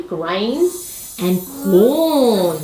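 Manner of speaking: usual speaking voice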